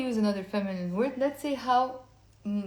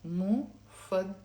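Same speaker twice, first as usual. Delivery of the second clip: مفضلة broken into "mu, fad, da, le"; four syllables. Shown as a demonstration. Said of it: "Four syllables".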